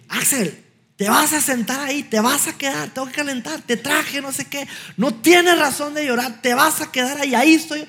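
high-pitched, insistent retold telling-off; angry scolding dad. Dad to crying son behind the bleachers, retold angry scolding. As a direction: act out a father losing patience and laying down orders. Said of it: "me puse bravo".

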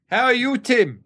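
high, chirpy Lebanese shopkeeper voice; Lebanese accent. Retold or imitated. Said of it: "She's Lebanese".

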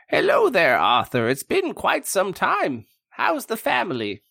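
put-on high, bright old friend greeting; cheery greeting.

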